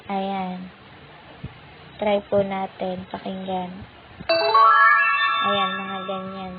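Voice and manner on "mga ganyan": usual speaking voice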